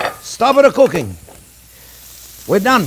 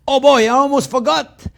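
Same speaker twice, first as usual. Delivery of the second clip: surprised; 8 semitones higher, 3 dB louder than usual. Real, not acted. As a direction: catch yourself remembering. Suddenly remembering to taste.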